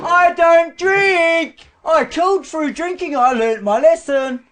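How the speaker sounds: loud, slow, high-pitched shout. Real, not acted. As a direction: bellow it out, very high. Sudden outburst on drinking.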